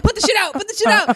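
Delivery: loud, high, frantic repeated order; panicked shouting. Retold or imitated. Driver ordering friends to kill the weed at the gate, panicked shout. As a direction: yell at the car full of friends in panic. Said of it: "I'm like".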